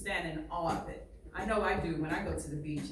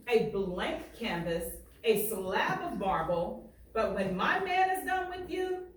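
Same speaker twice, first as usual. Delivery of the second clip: lively read-aloud, painting each picture; story reading; 4 semitones higher than usual. Acted. Teacher reading a barbershop story aloud to students, lively. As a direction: read it to the class with swagger and pride. Reads the book aloud to class.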